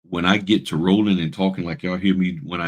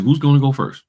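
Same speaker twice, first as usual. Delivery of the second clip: louder, higher host's call; 8 semitones higher, 8 dB louder than usual. Retold or imitated. Event host asking for the first speaker, called out loud. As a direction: call it across the room. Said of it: "They said".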